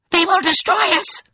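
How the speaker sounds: very high, loud frightened whimper; whimpering chorus. A narrator's reading. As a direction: wail it high like a host of terrified creatures. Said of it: "a host of voices whimpered".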